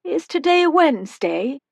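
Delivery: put-on a tiny old woman calling from the doorway; high-pitched old woman. A narrator's reading.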